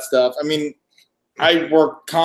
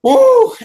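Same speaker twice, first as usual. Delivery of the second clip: high, loud "woo"; 8 dB louder than usual. Real, not acted. Weary guest greeting the stream, loud woo. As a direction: let out a high whoop. Exhausted sigh-off opening his turn.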